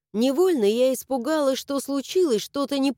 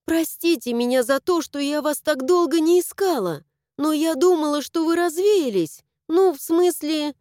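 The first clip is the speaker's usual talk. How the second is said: higher, urgent pleading; earnest, high-pitched entreaty; 6 semitones higher than usual. A narrator's reading. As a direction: beg forgiveness in a rush of words. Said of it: "и тут же взмолилась".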